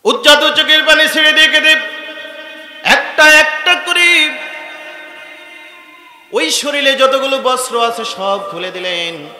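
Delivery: high, loud, chanted sermon cry; impassioned preaching. A narrator's reading. Preacher tells the crowd of a convert crying to his Lord, high loud chant. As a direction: preach it out loud and high, drawing out phrase ends. Full-force sermon narration.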